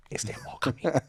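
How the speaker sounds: soft, tentative question; quiet puzzled quote. Retold or imitated. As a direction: ask it quietly, as a baffled listener. Acting out a puzzled audience.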